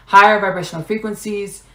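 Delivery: ordinary delivery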